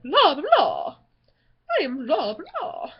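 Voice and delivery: swooping, playful vampire blah; exaggerated up-and-down vampire catchphrase; cartoon vampire impression. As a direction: goof around as a movie vampire. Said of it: "if there's gonna be, like".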